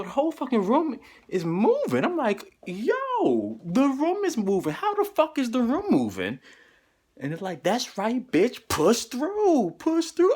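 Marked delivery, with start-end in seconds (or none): 2.7-6.4 high, astonished exclaim; retold amazement. Patient to himself in spinning room, high amazed cry. 7.6-10.3 taunting voice. Retold or imitated. Hallucinated voice taunting the patient, high goading chant. Voice in the room goads him.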